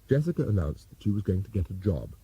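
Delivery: usual speaking voice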